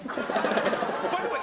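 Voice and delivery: normal talking voice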